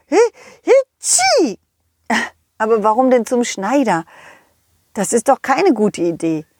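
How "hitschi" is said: squeaky high sneeze, drawn out. Acted. Snowman sneezes. (hohes, langgezogenes Niesen)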